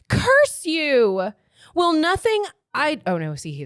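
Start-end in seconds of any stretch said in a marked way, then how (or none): 0.1-1.3 high, loud dramatic outburst; angry curse. Acted. Acted quarrel in the novel. 1.7-3.0 high, loud exasperated heroine; wrong character's voice. Acted. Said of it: "I got in the wrong person".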